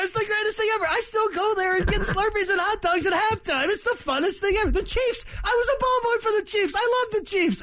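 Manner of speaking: put-on high, giddy kid-fan voice; mocking Hackett. Radio host mocking Hackett's gushing about his old teams. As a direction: gush like a starstruck ball boy.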